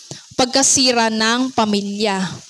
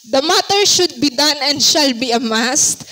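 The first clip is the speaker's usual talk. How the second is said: normal talking voice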